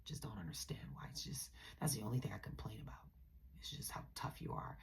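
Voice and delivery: whispered mock of the ex smearing her; voicing the ex as he badmouths [name], in a whisper. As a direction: hiss his gossip behind her back. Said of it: "he definitely started smearing my name".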